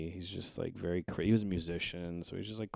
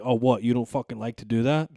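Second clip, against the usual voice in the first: loud incredulous dad; 4 semitones higher, 11 dB louder than usual. Retold or imitated. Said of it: "he's like".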